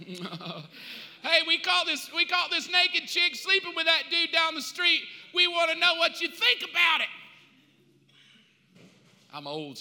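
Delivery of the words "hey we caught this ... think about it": loud, high accusing call; shouting accuser. Acted. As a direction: demand an answer at full voice. Pharisees accuse the woman.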